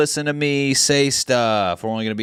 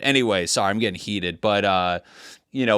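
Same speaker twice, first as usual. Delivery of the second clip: ordinary talk, nothing marked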